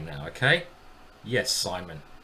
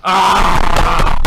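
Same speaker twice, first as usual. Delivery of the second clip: sudden very loud shocked yell; a sharp cry of real shock; real scream at a collapse; startled scream; 5 semitones higher, 17 dB louder than usual. Real, not acted. Sudden loss in chess game.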